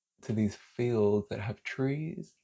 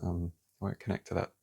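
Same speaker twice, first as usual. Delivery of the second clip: usual speaking voice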